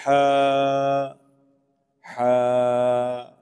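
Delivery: long 'ہا' with squeezed throat friction; throaty H. Shown as a demonstration. Teacher showing the other reading, held throaty H. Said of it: "ہا ادا نہیں کرتے".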